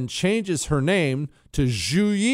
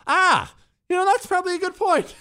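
put-on young boy voice. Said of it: "I said".

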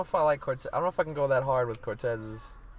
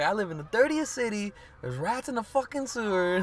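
imitating [name]; 9 semitones higher than usual. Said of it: "the way in her accent and shit".

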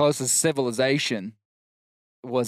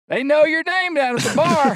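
teasing announcement; 5 dB louder than usual. Retold or imitated. Telling his mother.

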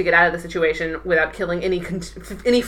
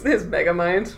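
Megamind voice; 4 dB quieter than usual. Pretend. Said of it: "screw old-timey radio voice, you have to talk like that".

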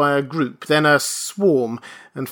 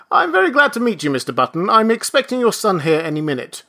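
lightly voiced brisk registrar, higher; 8 semitones higher, 4 dB louder, faster than usual. A narrator's reading. Registrar cuts off Benjamin, brisk and polite. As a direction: hurry over him politely.

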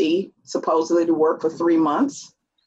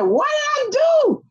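shocked. Retold or imitated. Retelling her own shocked reply.

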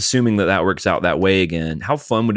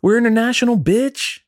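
hyped shout. Acted. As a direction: shout it like a proud bragging fan. Hyped exclamation.

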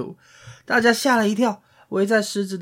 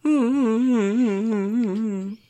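high whimpering mock sobs; mock piglet sobbing; 7 semitones higher than usual. Piglet crying after licking.

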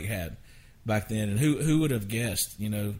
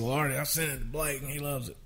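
Craig Wiseman impression; 3 semitones higher, 4 dB quieter, faster than usual. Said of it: "I'm doing a Craig Wiseman imitation".

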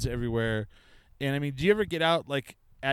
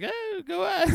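dismissive shrug; much faster than usual. Retold or imitated. Retold brush-off to neighbor.

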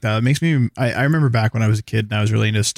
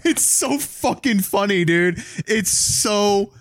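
laughing; 9 semitones higher, much slower than usual. Real, not acted. Laughing at card-game kids.

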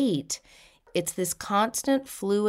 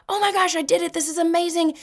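much higher, excited squeal of a rider; thrilled squeal; 11 semitones higher, 4 dB louder, faster than usual. Retold or imitated. Rider at the top of the roller coaster, squealing with thrill. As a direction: squeal like you're at the top of the drop.

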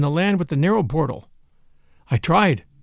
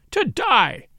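raised, contained narrator's shriek; 5 semitones higher than usual. A narrator's reading. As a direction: repeat the cry, pitched high. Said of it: "he shrieked".

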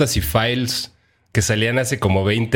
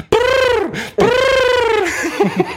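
loud, high-pitched trilling brrr; high rolled lip trill; 4 dB louder than usual. Acted. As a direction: trill it out like a cheer. Celebrating the pick.

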